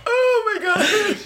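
laughing. Real, not acted. Cracking up after the face.

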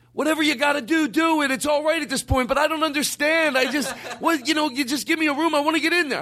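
very high, frantic exasperated rant; blowing up. Acted. Guest snapping at clerk over charge, blowing up. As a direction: lose it in a high-pitched comic tirade. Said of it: "I blow up".

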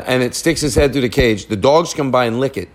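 usual speaking voice

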